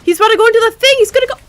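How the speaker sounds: high, loud, thrilled cry; excited shout. Real, not acted. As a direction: call it out in real suspense. Thrilled at game moment.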